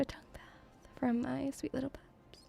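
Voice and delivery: teasing whisper. Pretend. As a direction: whisper it slyly to the audience.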